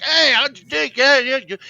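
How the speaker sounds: high, loud hyped-up babble; hammed-up boisterous hello; imitated excited greeting. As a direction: act out a wrestler whooping up to fans. Imitates excited wrestler greeting the crowd.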